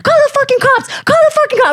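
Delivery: very high, furious re-enacted yell; retold screaming. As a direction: dare him in a high-pitched scream. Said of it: "I just started screaming".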